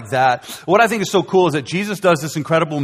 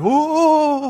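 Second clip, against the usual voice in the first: crowd's ooh; 12 semitones higher than usual. Retold or imitated. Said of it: "and the crowd's like".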